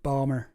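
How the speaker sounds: "Balmer", Baltimore squashed into two syllables; Baltimore without T. Shown as a demonstration. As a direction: drop the T and middle of Baltimore: "Balmer". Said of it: "don't pronounce the T".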